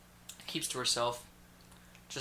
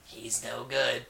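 mock warning; 3 semitones lower, much slower than usual. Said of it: "Voices were spot on".

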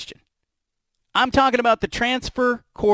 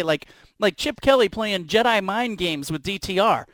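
usual speaking voice

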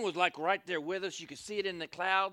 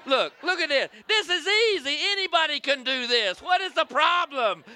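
higher and louder, laughing gym bravado; Pastor Josh at CrossFit; 8 semitones higher, 8 dB louder than usual. Retold or imitated. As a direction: show off how easy the workout is. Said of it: "Kinda like Pastor Josh at CrossFit".

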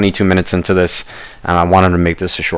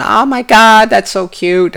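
high-pitched imitation of a cooing wife; gushing wife; 13 semitones higher, slower than usual. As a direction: coo over it in a put-on high voice. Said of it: "the wife's sitting there going".

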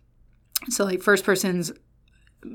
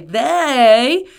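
"They" louder, higher and stretched; heavy emphasis on They; 4 semitones higher, 6 dB louder than usual. Shown as a demonstration. Preacher points out the Greek pronoun, heavy stress on They. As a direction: swell loudly on a long "They". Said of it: "the emphasis that I think".

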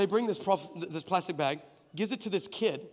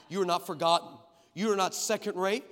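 usual speaking voice

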